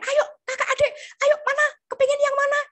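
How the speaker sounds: put-on high, loud, excited call to the children; bright sing-song call to the class; coaxing kids. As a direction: cheer the kids on like a Sunday school teacher. Acts children's teacher coaxing kids.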